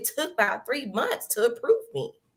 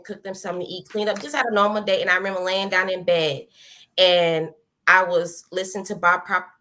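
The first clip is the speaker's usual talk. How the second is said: ordinary talk, nothing marked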